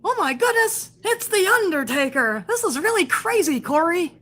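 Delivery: hammed-up high amazed play-by-play; mock overexcited commentator. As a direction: parody a commentator gushing at a big entrance. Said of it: "And this guy will go".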